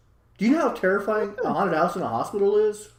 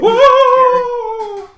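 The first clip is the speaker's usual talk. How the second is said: long high holler, very loud; sustained high mock scream; imitated scream; 13 dB louder than usual. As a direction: wail it out like a spooked crowd. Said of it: "they're screaming and hollering at him".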